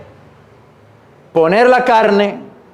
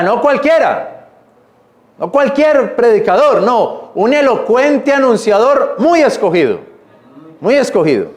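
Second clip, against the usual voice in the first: ordinary talk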